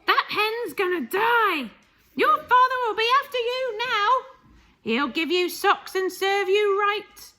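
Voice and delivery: high, loud scolding grandmother; Grandma shouting. Acted. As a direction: tell the boy off furiously. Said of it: "Grandma shouted".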